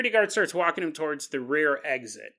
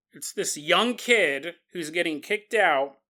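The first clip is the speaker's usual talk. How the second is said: usual speaking voice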